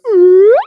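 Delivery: loud, high rising whoop; mock sex noise. Shown as a demonstration. Claiming his noise during sex, loud high whoop. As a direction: shoot up into a loud ooh. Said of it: "Sometimes during sex, I go".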